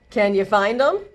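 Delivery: plain reading voice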